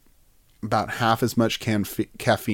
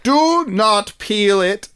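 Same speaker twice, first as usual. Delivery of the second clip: solemn mock command; 7 semitones higher, slower than usual. Improvised Mother Earth speech.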